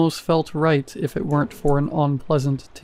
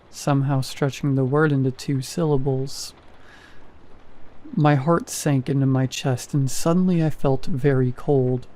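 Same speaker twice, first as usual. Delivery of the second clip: plain reading voice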